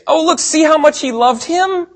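high-pitched mock onlooker voice; acted onlookers. Preacher voicing onlookers who see Jesus cry, mock-touched. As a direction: play a touched bystander, pitch lifted high.

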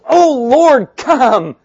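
very loud, high plea; crying out. Retold or imitated. Preacher voices Paul calling on the Lord, crying out. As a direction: call out to heaven at full force.

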